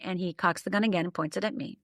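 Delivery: usual speaking voice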